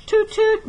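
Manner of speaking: very high tooting like a boat horn. A narrator's reading. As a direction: imitate a boat whistle. Boats hooting in story.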